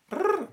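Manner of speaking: rolled brrr for a ringing phone; imitated phone ring. Storyteller mimicking an incoming call, trilled phone ring. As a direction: trill it like a ringtone.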